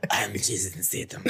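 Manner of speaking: low, soft tough-guy impression; put-on low action-hero voice; Jason Statham. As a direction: drop into an action-star growl. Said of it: "back to the Jason Statham voice".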